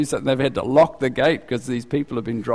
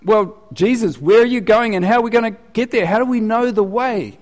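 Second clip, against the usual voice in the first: higher, louder, rapid puzzled questions; Thomas asking; 8 semitones higher, 4 dB louder than usual. Acted. Preacher voices Thomas pressing Jesus for directions, higher, louder and quick. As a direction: press Jesus like a practical man. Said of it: "So Thomas is like".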